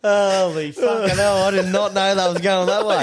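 high, slow laughing disbelief at the twist; real laughter through the words. Real, not acted. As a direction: laugh it out, caught off guard. Stunned by the joke's twist.

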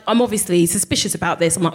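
ordinary talk

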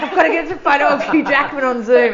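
high, loud, laughing fluster; starstruck excitement. Retold or imitated. Student scrambling for phone in class, starstruck fluster. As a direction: blurt it out like a thrilled fan.

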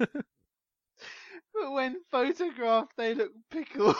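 squeaking through suppressed laughter; hysterical, high-pitched. Real, not acted. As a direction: read it high, on the verge of cracking up. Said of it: "hysterical, high-pitched voice".

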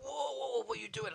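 high-pitched imitation of alarmed onlookers; mock alarm. Passengers catching him at the door, mock alarm. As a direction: yelp it as the startled crowd. Voices alarmed crowd in story.

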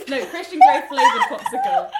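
high, loud laughing burst; laughing loudly. Real, not acted. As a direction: blurt it out through big laughter. Laughing correction of the quote.

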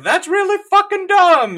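high, loud jester's jeer; mocking court jester. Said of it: "just being like".

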